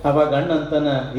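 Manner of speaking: normal talking voice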